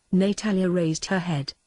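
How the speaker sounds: plain reading voice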